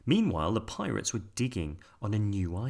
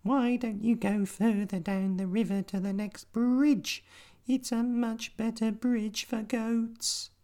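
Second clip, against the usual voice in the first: very high, slow squeaky spider; tiny spider voice. A narrator's reading. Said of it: "said the spider".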